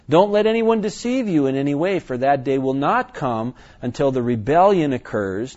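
scripture reading. Acted. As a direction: warn them firmly from the text.

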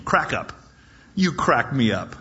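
ordinary talk

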